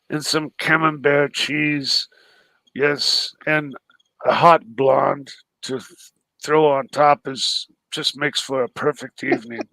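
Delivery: low, measured wine-connoisseur voice; Ocean. Acted. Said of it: "You can do an Ocean".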